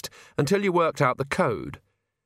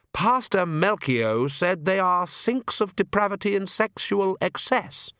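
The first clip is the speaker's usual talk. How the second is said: high, prim recital; slow, high-voiced pious dismay; Oates, nervous young priest; 8 semitones higher, slower than usual. A narrator's reading. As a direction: quote the pastor in anxious disapproval.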